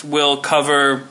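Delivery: usual speaking voice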